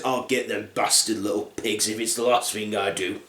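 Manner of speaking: low, quiet muttered vow; vengeful wolf. Acted. Scalded wolf vowing revenge on the pigs, low and quiet. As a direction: grumble a grudge under your breath.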